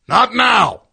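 angry yell. Retold or imitated. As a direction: bellow it like an impatient musician cutting someone off. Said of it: "he, like, yells at me".

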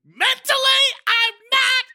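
high shrieking scream. Pretend. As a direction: shriek it at the top of your voice. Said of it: "She's screaming".

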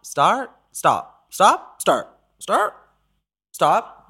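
high rising one-word questions; playful questioning. Acted. Host teasing the caller with high rising start and stop. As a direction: toss the words up like a tease.

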